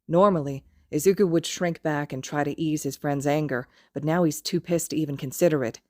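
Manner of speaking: usual speaking voice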